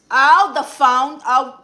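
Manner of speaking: loud, drawn-out sudden remembering; light up as it comes back to you; excited recall. Real, not acted. Host suddenly remembering the phone call viewers asked about, excited.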